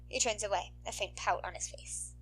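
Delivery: usual speaking voice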